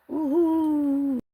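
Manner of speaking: high, loud woohoo; excited cheer. Real, not acted.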